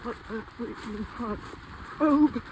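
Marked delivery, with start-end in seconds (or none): 2.0-2.4 excited. Real, not acted. Celebrating the kill.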